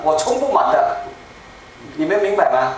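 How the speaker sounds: usual speaking voice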